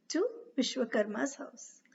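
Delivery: usual speaking voice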